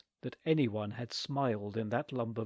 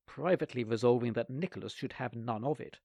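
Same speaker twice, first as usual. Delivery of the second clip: plain reading voice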